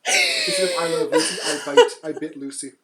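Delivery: loud, laughing joke delivery; laughing punchline. Real, not acted. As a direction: crack yourself up on the pun. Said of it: "I wasn't ready for that".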